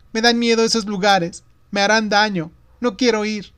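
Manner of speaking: put-on high, loud frightened child; whimpering fear. A narrator's reading. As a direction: plead like a scared little boy. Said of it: "gimió". (niño asustado gimiendo)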